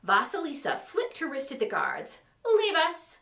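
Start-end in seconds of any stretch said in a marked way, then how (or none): 2.4-3.2 high, loud lady's command; imperious dismissal. A narrator's reading. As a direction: dismiss them high and firmly. Lady dismissing her guards.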